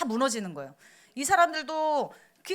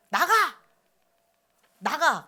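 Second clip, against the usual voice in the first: put-on barked out at a child, louder; mock angry yell; 3 semitones higher, 6 dB louder, slower than usual. Parent shouting at a child to get out. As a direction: imitate a parent yelling get out. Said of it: "이렇게 저주하는 소리".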